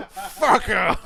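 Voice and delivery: hammed-up slurred insult through a laugh; mock Hopkins stroke voice. Retelling Nash's Hopkins bit to his co-host, laughing and hammed up. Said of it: "You gonna give him the Anthony Hopkins?".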